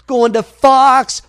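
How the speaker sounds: loud, high, drawn-out taunt; trash-talking. Retold or imitated. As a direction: crow it slowly at a rival, pitch climbing to the end. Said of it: "talking all this smack".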